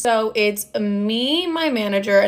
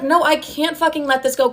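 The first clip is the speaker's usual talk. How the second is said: retold heated pushback, pitched up; higher, exasperated protest; emphatic refusal; 5 semitones higher than usual. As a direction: push back hard, acting out the argument. Retold refusal to her mother.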